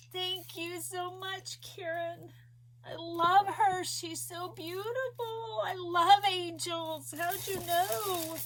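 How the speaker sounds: high, crying thanks; tearful thanks. Real, not acted. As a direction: thank her while choking up. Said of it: "Sorry, guys, for bawling".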